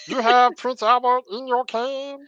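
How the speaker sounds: high, squeezed cartoon voice; Meatwad voice. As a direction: do a goofy high cartoon character. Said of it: "that just sounds like Meatwad".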